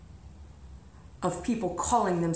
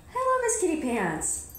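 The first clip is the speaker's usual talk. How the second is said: cat greeting; 11 semitones higher than usual. Real, not acted.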